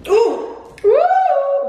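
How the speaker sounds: high ooh from the heat; burning mouth gasps. Real, not acted. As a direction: gasp at the burn. Reacting to spicy wing heat.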